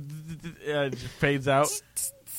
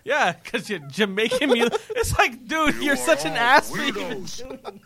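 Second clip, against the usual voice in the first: high-pitched real laughter through the words; laughing while talking; 8 semitones higher than usual. Real, not acted. Host retelling the Jamaican pun, laughing helplessly. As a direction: struggle to speak through giggles. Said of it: "I'm laughing by proxy".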